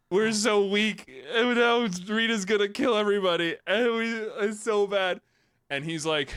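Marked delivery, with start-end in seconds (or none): 0.1-5.2 whiny despair. Retold or imitated. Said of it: "they're like".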